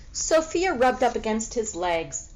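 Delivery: ordinary delivery, nothing marked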